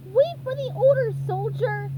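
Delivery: high, loud drill-sergeant bark; barked order. A narrator's reading. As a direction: bark it like a tiny general. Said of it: "barked SpongeBob".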